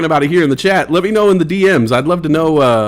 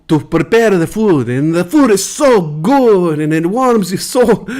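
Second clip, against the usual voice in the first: higher, hammed-up Italian accent, dreamy about Milan food; 4 semitones higher than usual. [name] tells chat about Milan food, hammed-up Italian accent. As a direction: savour every word like an Italian missing home. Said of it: "the [name] Blanco Show".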